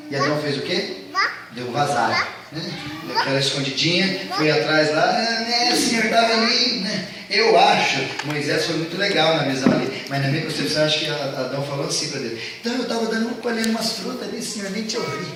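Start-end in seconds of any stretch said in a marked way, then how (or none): 5.0-7.1 higher, sheepish excuse voice; caught-out Adam making excuses, pitched up. Acted. Adam's sheepish excuse to God. 12.6-15.3 higher, softer lame-excuse voice; Adam fibbing to God in a higher, sheepish voice; weak excuse. Acted. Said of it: "Aquele chaveco fraco".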